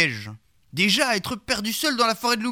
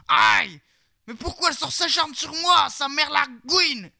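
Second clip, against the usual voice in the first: pained yell; 5 semitones higher than usual. A narrator's reading. Knight burned by the glare of his gun, pained cursing yell. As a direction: yelp from the burn, then swear it out. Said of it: "hurla le sieur Lacaïra".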